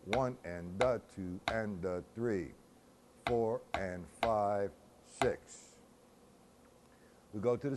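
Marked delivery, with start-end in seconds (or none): 0.1-5.7 slow, steady count in time; measured rhythmic counting; complete measure count. Shown as a demonstration. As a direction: count the whole measure out evenly.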